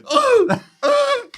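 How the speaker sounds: high, loud strangled "oh" of held-in laughter; squeaky mock gasps, trying not to laugh; stifled laughter. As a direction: imitate fighting back a laughing fit. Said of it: "I'm trying not to laugh".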